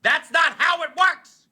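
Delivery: high, scolding explanation; lightly voiced fed-up believer correcting him.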